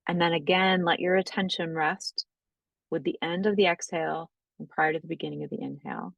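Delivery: calm, unhurried breathwork guidance; guided meditation. Acted. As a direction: ease the class gently into noticing the breath. Leads a guided breathing practice.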